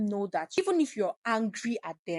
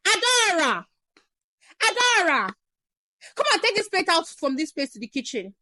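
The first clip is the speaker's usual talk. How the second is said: higher, louder calls, hammed-up yelling across the house; yelling mom; 8 semitones higher, 7 dB louder than usual. Mom calling daughter to clear her plate, loud yell. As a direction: bark her name, then the order. You're not to yell at Adora.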